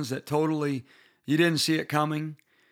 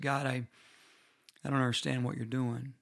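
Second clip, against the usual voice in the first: hushed grieving prayer; 3 semitones lower, 8 dB quieter than usual. Retold or imitated. Pastor retells prayer after death.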